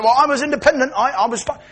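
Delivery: high, loud self-justification; defensive protest. Acted. Bullying singer defending his independent life, defensive protest.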